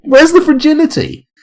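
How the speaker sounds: very high falsetto cry; hammed-up high lament; mock wail. Mock wail after failed attempt.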